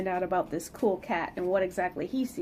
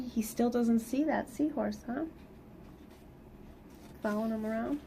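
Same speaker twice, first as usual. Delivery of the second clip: usual speaking voice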